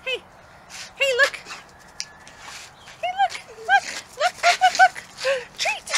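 high bright coaxing calls of look. Real, not acted. Owner luring dog with a treat, high coaxing. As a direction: coax the dog with a high lilting voice.

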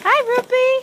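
high, loud sing-song hello; cutesy greeting. Real, not acted.

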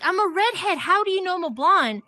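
high-pitched exasperated protest, acted out; frustrated. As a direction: protest in exasperation as the joke's blonde. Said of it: "She gets frustrated".

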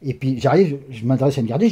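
usual speaking voice

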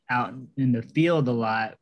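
ordinary talk

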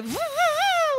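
high voo warm-up. Shown as a demonstration. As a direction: sing voo up high in head voice. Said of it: "let's just go".

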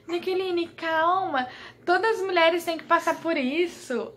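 high-pitched imitation of a nurse; nurse urging calm. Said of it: "a enfermeira falava assim". (imitando a enfermeira pedindo calma, voz aguda)

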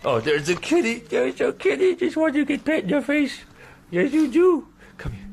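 very high squeaky cooing. Real, not acted. Streamer fussing over the cat, high baby-talk cooing. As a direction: coo at a kitty in a tiny high voice.

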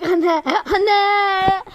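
loud, high excited cry; excited shout. Real, not acted. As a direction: yell out in excitement. Kids crying out in game.

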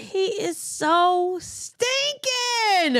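high, slow, drawn-out squeal of adoration; adoring gush. Real, not acted. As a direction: stretch it out, overcome by cuteness. Gushing over the baby.

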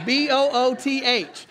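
"B O O T H" letter by letter, slow and deliberate; spelled out slowly. Shown as a demonstration. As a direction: spell "B O O T H" out one letter at a time. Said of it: "Booths with a T H".

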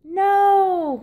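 complaining no. A narrator's reading. Skaters complain to the coach, a high whiny no. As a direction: groan a protest. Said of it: "complained the skaters".

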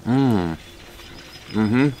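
humming while chewing food; closed-mouth chewing sounds; mouth full, chewing. Real, not acted. Seen 0: Lips pressed and working after an egg bite at -1.0.

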